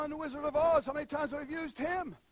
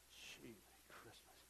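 breathless whispered wonder, much softer; awed whisper; 13 semitones lower, 26 dB quieter, slower than usual. Real, not acted. Pastor marvelling to the congregation, awed whisper. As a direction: breathe it out in awe. Awed aside at God's promise.

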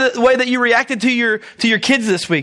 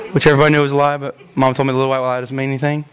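rushed low aside; 12 semitones lower, much faster than usual. Acted. Quick aside in lower voice.

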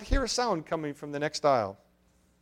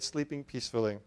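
ordinary delivery, nothing marked

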